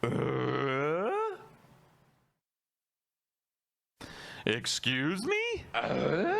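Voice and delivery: high bleating confusion; confused sheep. Pretend. Mock baffled sheep noises.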